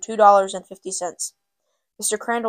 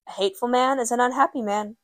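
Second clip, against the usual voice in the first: ordinary delivery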